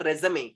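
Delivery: Resume as "re-zuh-may", falling; three syllables in Resume, last one "may"; correct résumé. Shown as a demonstration. Teacher answering own question, Resume as "re-zuh-may". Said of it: "It is what?".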